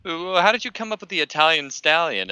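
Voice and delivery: a touch of an eager reporter. Retold or imitated. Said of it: "he's like".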